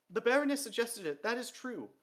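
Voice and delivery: high, loud, hurried admission; frightened confession. A narrator's reading. Dee blames the Baroness to the king, frightened. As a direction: give her up to save yourself.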